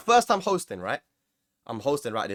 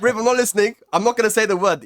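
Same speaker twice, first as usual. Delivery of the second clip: higher, louder retold refusal; insistent protest; 6 semitones higher, 6 dB louder than usual. Host telling Rip he's not listening, insistent protest. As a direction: firmly shut down the teasing, pitched up.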